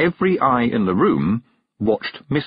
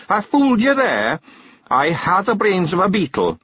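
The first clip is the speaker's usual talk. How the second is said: proud pixie; 10 semitones higher, 4 dB louder than usual. A narrator's reading. Daft Willie answers the witch, proud silly pixie. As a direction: crow with daft pride.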